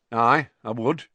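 lightly voiced campsite owner, plain and unexcited; a hint of a gruff Muggle. Mr Roberts flatly returning the greeting, lightly voiced. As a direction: answer without enthusiasm.

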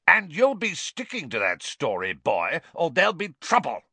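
snarling threat, spat out. A narrator's reading. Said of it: "spat Uncle Vernon".

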